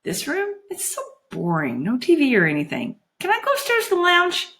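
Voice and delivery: put-on high girlish whine; pouting complaint; frowning, sulky. A narrator's reading. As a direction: plead like a bored child. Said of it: "Juliet frowned".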